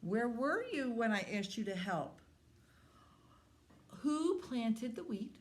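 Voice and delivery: high, indignant storybook hen; scolding hen. A narrator's reading. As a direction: pitch it up and demand each answer. Hen scolds friends who didn't help.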